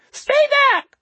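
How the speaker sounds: loud, piercing high squeal; squealing homeless man. A narrator's reading. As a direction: squeal it as a warning. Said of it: "the homeless man squealed at Hayward".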